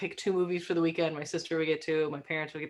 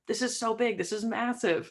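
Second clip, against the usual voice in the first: higher-pitched, awed exclamation; excited amazement; 6 semitones higher than usual. Retold or imitated. Guest recalling the star filming locally, excited and amazed. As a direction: retell being hugely impressed. Retells own amazed reaction to news.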